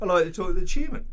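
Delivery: loud, high restated demand; emphatic request. Retold or imitated. Buyer repeating his request to a sales agent, emphatic.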